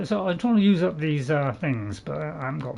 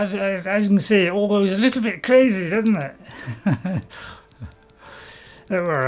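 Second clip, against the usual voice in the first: ordinary talk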